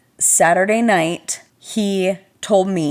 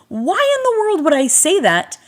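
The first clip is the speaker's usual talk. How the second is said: 1 exasperated disbelief; 8 semitones higher than usual. Real, not acted. Self-exasperated outburst.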